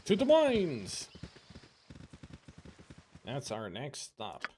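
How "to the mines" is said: slow, grand call to adventure; rally the party onward; rallying cry. Acted.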